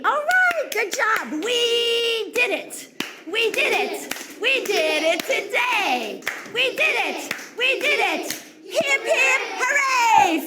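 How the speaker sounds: loud, high cheering; excited cheer. Acted. Teacher cheering her class loudly after they finish. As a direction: whip the class into a victory cheer.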